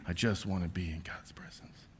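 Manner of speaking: soft whispered longing; hushed prayerful whisper. Real, not acted.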